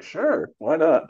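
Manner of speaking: high, loud, stretched-out "sure"; awkward agreeing. Retold or imitated. Actor agreeing to do the accent, awkwardly game. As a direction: play up a gamely unsure yes. Retold awkward acceptance of director's offer.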